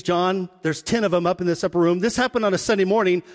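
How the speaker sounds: usual speaking voice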